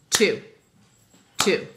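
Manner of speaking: one loud beat clapped out with the class; clapped syllable. Shown as a demonstration. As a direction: clap it out, one big beat. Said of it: "Let's clap".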